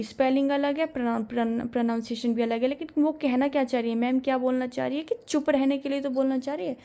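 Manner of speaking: ordinary talk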